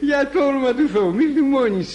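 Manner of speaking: high, whining plea; drunken pleading. Acted. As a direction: beg her not to be angry. Drunk pleading with angry Sophie.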